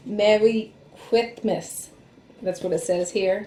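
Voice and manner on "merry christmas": slow, with tongue out; tongue sticking out. Shown as a demonstration. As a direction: say it with your tongue stuck out. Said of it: "if you stuck your tongue out and try to say".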